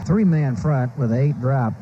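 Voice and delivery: ordinary talk, nothing marked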